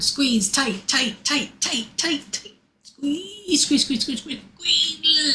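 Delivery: high, rapid-fire squeezing chant; urgent coaching. Real, not acted. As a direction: push them through the final effort. Urging group through last squeeze.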